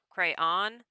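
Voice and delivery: "crayon" sounded out as cray-awn; "crayon" in two parts, testing the ending; rhymes with dawn. Shown as a demonstration. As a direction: say "crayon" with a drawn-out second syllable, like dawn. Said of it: "Second syllable rhymes with dawn".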